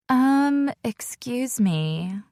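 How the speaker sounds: drawn-out slow whiny voice; fake whiny voice. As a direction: whine it like a fake sweetheart. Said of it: "Tina uses her fake whiny voice".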